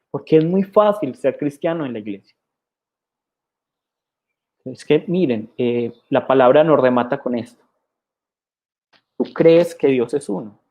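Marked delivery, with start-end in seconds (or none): none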